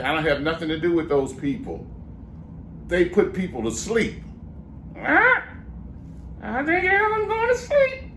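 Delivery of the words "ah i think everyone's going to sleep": very high squawky parrot speaking. Acted. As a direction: talk back as a cheeky parrot, very high. Parrot dummy jokes.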